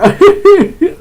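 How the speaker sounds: loud burst of laughter. Real, not acted. Laughs at own impression.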